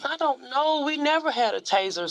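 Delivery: high-pitched real protest; protesting denial. Real, not acted. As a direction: deny it defensively, voice climbing high. Mother keeps denying the accusation.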